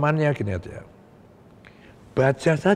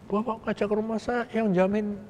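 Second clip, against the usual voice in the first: much higher and softer, a hammed-up worried rebel; rebel's worried reply; 9 semitones higher, 6 dB quieter than usual. Rebel leader answering the general's invitation home, in a high, soft, worried voice. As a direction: imitate the rebel's nervous protest, pitched way up.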